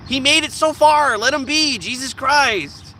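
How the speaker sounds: high, loud, exasperated outburst; worked-up, high-pitched protest; exasperated plea. Real, not acted. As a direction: plead indignantly, dragging out the last words. Exasperated plea for the old animal.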